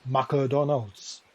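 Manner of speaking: put-on McDonald's in a Japanese accent as Ma-ku-Donaldo, every syllable given equal weight. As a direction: imitate a Japanese friend saying McDonald's with no dropped syllables. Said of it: "Japanese friend of mine pronounced it as".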